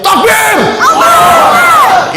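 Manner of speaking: imitating a rally cry of Takbir, very high and loud; takbir shout. Preacher imitating FPI members shouting takbir at a rally, full-throated yell. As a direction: shout it like a crowd leader at a rally. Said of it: "teman-teman F P I teriak".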